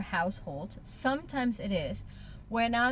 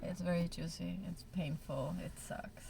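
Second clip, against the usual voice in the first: fading to quiet; 5 semitones lower, 11 dB quieter than usual. Real, not acted. Admitting painful feelings, fading.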